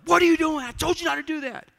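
high, loud scolding shout; re-enacted outburst of an angry parent; angry yell. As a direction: yell in exasperation, pitch shooting up. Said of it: "I yell at her".

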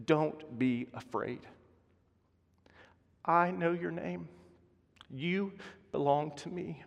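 soft, slow and reassuring; God comforting a frightened child, softly; tender voice of God. Acted. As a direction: speak it like a gentle promise.

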